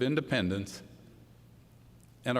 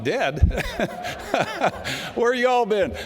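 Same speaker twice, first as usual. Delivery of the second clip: laughing scoff, pitch jumping high and louder; laughing disbelief; 9 semitones higher, 4 dB louder, slower than usual. Retold or imitated. To the crowd, mocking Tea Party doubters, with a high laughing scoff. Mocking people who call it dead.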